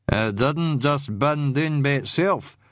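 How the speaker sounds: slow, grudging islander mutter; surly muttered accusation; muttering man. A narrator's reading. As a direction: grumble it slowly with suspicion. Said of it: "one man muttered".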